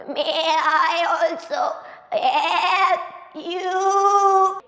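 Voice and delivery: slow, bleating goat asking to help; drawn-out goat plea. Acted. Farm goat politely asking the family to help. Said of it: "saw a goat".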